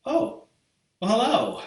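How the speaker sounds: oh-hello mock surprise; feigned surprise. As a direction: pretend to just notice a visitor. Host feigns noticing the viewer.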